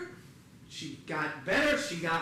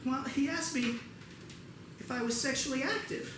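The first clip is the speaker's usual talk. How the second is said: higher, softer reluctant confession; lightly voiced bashful mom; embarrassed mother; 5 semitones higher, 5 dB quieter than usual. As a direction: admit it delicately as Mom. Mother shyly recounts doctor's question.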